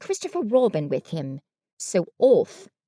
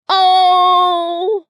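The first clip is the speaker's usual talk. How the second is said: muffled groan; 8 semitones higher, 10 dB louder than usual. A narrator's reading. Pooh stuck in jar.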